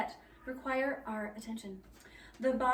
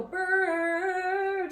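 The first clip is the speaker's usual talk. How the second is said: drawn-out "brrr" held on the R; long R sound; 6 semitones higher, 5 dB louder than usual. Shown as a demonstration. Teacher showing choir the R sound, long held brrr. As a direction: hold one long, sung "brrr". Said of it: "you're supposed to roll the R".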